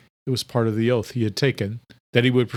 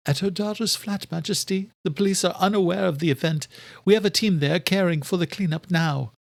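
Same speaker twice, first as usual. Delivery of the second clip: higher servant report; Adela's voice; 7 semitones higher than usual. A narrator's reading. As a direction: explain to the king, higher voice.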